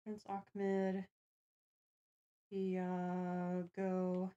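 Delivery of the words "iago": ordinary talk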